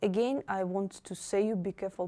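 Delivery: ordinary delivery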